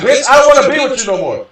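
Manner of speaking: high, loud retold yell at her; retold screaming. To his ex-girlfriend while breaking up, a loud retold scream. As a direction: belt it out like the breakup fight. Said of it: "I was screaming at her".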